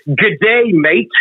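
mock an American's Australian greeting; American imitating Australian. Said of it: "Americans find out you're Australians".

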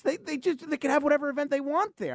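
quoted protesting owner. Retold or imitated. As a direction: act out the owner pleading innocence. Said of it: "the owner says".

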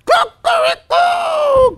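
very high, loud crowing cock-a-doodle; rooster crow. A narrator's reading. The father turned rooster crowing in the room. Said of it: "קרא התרנגול".

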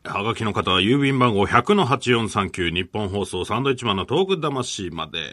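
loud, high address announcement; radio announcer read. Acted. Host telling listeners where to send postcards, loud announcer read. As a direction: project the mailing address like an announcer.

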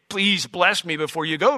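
normal talking voice

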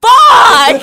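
shrieking high and loud through a laugh; laughing scream of pain. Retold or imitated. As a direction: yell it like it's on fire. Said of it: "I was literally screaming Bloody Mary".

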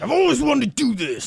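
high, excited mock attacker voice; imagined attacker. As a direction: pipe it up like a gleeful pretend mugger. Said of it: "He's like".

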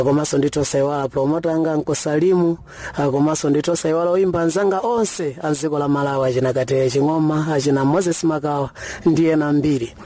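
ordinary talk, nothing marked

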